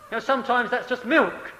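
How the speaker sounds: ordinary talk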